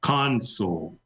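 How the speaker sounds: "console" with CON- leaned on; stress on first syllable. Shown as a demonstration. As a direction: say "console" as the noun, CON-sole. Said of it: "pronounce the first syllable the way I did".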